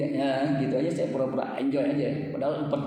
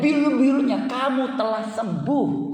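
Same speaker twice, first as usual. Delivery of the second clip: loud, raised proclamation; forceful preaching; 5 semitones higher, 4 dB louder than usual. Acted. Preacher quoting scripture to the congregation, proclaiming loudly. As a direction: declare the verse with force.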